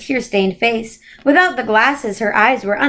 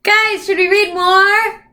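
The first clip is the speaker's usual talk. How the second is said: singsong host; 9 semitones higher, slower than usual. Pretend. Sing-song to kids.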